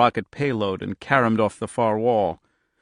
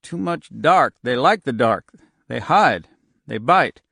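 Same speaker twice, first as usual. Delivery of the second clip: frightened; 5 dB louder than usual. A narrator's reading. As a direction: blurt it out in panic. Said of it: "eyes shifted back and forth wildly".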